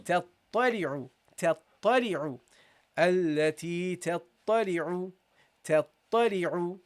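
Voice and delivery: تطلع with doubled t and ain; teacher modelling تطلع; careful recitation. Shown as a demonstration. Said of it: "that's how you pronounce it".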